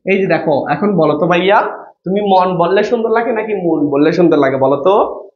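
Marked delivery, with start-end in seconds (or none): none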